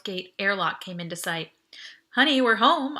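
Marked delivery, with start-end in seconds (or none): 2.2-3.0 louder, playful sitcom call; joking sitcom line. Acted. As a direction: ham it up to hide the relief. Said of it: "I joked".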